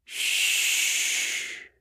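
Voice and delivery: comforting whispered shh; comforting shush. A narrator's reading. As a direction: hush him gently. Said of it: "in a comforting tone".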